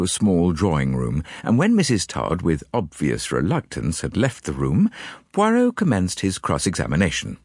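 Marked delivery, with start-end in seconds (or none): none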